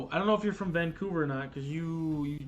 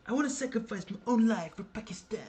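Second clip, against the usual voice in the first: high-pitched, hammed-up quote of an impassioned lady; mock-fervent, raised voice; imitating Pakistani lady; 7 semitones higher, 4 dB louder than usual. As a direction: mimic a woman's fervent patriotic declaration. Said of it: "the lady where she's like".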